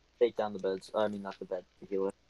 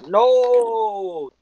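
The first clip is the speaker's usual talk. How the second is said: high, loud cry of alarm; sudden high panicked yell; panicked shout; 12 semitones higher, 9 dB louder than usual. Real, not acted. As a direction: yell no as it all goes wrong. Reacting to in-game disaster.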